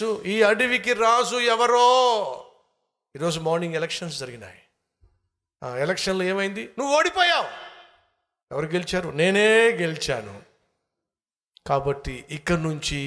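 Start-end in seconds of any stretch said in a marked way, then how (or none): 0.0-2.3 loud, high, long-drawn challenge; slow, raised-voice taunt; taunting proclamation. Acted. Acted challenge over who is king. 6.8-7.5 high, loud taunt; sharply raised, high-pitched announcement; gloating fox. Acted. Fox tells lion he lost. 9.1-10.4 slow, loud victory claim; stretched, emphatic boast; proud fox. Acted.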